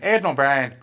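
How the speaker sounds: lightly done Irish accent on the name. Shown as a demonstration. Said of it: "You gotta say it in an Irish accent".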